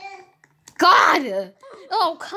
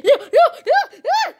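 high, loud squeals of no through laughter; panicked laughing no; 14 semitones higher, 7 dB louder than usual. Real, not acted. Player caught by the monster, squealing in laughing panic. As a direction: squeal it as the monster grabs you.

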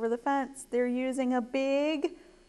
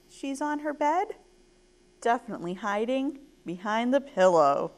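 plain reading voice

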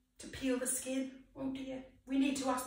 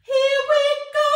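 loud cue; 11 semitones higher, 12 dB louder than usual. Real, not acted. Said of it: "cue to sing".

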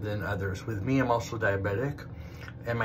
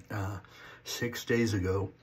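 usual speaking voice

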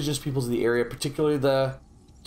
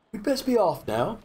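higher-pitched mimicry, lifting at the end; mock character echo; 7 semitones higher than usual. Player echoing the game hero's parting line, mock voice. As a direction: parrot the line in a put-on high voice.

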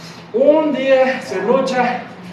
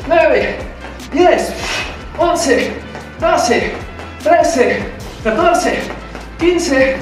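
barking gym coach; 5 semitones higher, slower than usual. Acted. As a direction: bark out each rep to the class. Coach counting reps in class.

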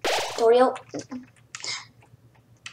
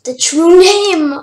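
slow, loud, higher proclamation of the true name; dramatic reveal; 5 semitones higher, 8 dB louder, slower than usual. Acted. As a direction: announce it like a grand revelation. Reading a dramatic game reveal.

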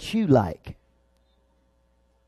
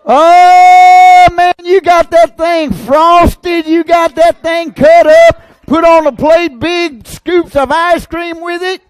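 high, loud preacher's build; fiery preaching; 11 semitones higher, 12 dB louder than usual. Real, not acted. Preacher firing up the church about sugar and salt, loud and high. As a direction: drive it home to the congregation.

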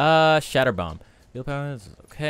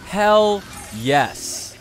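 loud thrilled cheer; excited; 6 semitones higher, 7 dB louder, much slower than usual. Real, not acted. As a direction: hype it up, loud and happy. Thrilled by new gadget.

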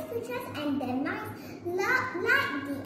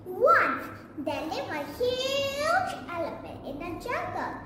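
ordinary delivery, nothing marked

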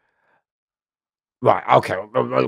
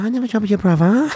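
higher meek denial through a laugh; playing dumb; 11 semitones higher, 4 dB louder than usual. Denying again to the gang member, mock meek. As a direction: play innocent, half laughing. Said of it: "I said".

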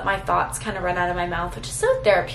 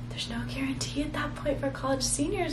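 whispered, voice held back by emotion; emotional whisper; 5 semitones higher, 9 dB quieter than usual. Real, not acted. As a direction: let it drop to a breathy hush. Emotional goodbye to college.

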